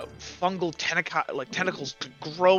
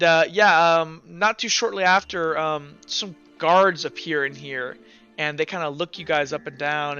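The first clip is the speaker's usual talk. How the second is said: normal talking voice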